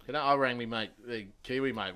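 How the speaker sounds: ordinary talk, nothing marked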